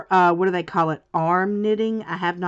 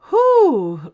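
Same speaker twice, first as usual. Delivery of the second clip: loud winded exhale, pitched up; breathless whew; 9 semitones higher, 7 dB louder than usual. Real, not acted. Winded after the dog chase, a loud whew. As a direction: blow out a tired breath. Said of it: "I'm a little out of breath".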